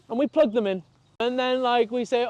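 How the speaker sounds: ordinary talk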